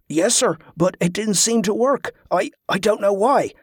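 high, faltering subordinate voice; flustered sailor unsure of himself; nervous sailor. A narrator's reading. Nervous sailor answering angry superior.

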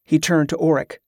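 plain reading voice

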